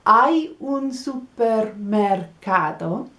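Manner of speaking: slow, careful demo of the phrase; slow model phrase. Shown as a demonstration. Teacher modelling the phrase slowly for learners. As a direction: say it slowly so they can copy. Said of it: "all you need to do is make one sound".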